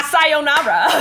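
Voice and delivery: farewell in Japanese with hammed-up Southern accent. Co-host mimics a TikTok woman, Southern-accented Japanese. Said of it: "speaking Japanese in a Southern accent".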